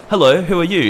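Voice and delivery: a bright phone greeting, played up; mock caller. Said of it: "he's just like".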